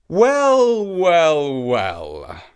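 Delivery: grand god, slowly sinking tone; impressed sky god. Acted.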